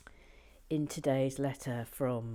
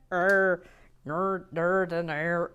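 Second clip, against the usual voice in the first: badly faked Northern Irish vowels; attempted Northern Irish accent; 5 dB louder, slower than usual. Shown as a demonstration. Showing she cannot do it, attempted Northern Irish accent. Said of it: "have you seen me trying to".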